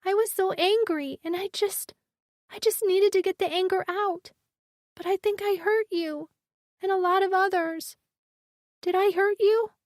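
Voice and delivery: put-on high, small child voice close to tears; little girl voice, frightened and guilty. A narrator's reading. Riven, scared child, voiced.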